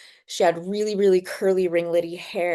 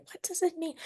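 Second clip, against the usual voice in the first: curious viewer; 10 semitones higher, 8 dB quieter than usual. Retold or imitated. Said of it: "and you're like".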